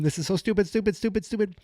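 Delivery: high, frantic repeating of stupid; panicked self-scolding. Retold or imitated. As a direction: psych himself up in a panic. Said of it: "And boom".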